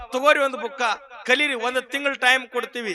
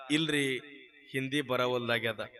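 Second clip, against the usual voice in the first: much lower and softer, defeated; dejected admission; 12 semitones lower, 10 dB quieter than usual. Acted. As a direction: admit it meekly, voice sinking. Priest admits failure to committee.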